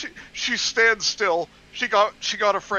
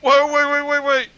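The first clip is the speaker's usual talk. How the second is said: urgent shout; 7 semitones higher, 8 dB louder than usual. Real, not acted. Interrupting excitedly.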